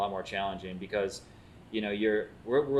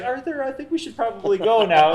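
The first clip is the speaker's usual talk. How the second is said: friend pulling him away; 12 semitones higher, 9 dB louder than usual. Retold or imitated. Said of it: "she's like".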